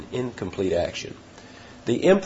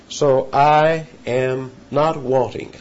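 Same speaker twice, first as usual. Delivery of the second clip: slow emphatic confession; 3 semitones higher, much slower than usual. Shown as a demonstration. Preacher voices sheep's confession.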